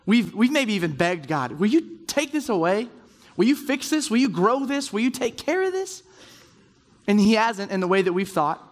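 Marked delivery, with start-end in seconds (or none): 1.5-6.0 high, pleading plea to God; desperate prayer, voice climbing high. Pretend. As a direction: rise in pitch as the begging grows.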